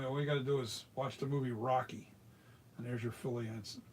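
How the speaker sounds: ordinary talk, nothing marked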